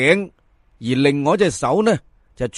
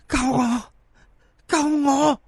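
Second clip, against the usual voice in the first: high, soft haunting cry for help; a corpse moaning for rescue, pitched high; ghostly plea; 9 semitones higher, 3 dB quieter, slower than usual. Acted. Corpse pleading again to the terrified Fan, eerie high moan.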